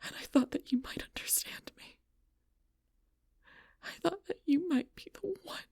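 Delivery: high, breaking half-whisper; wounded and barely voiced; hurt confession. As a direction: drop from shouting to a wounded, high hush. Hurt confession after shouting.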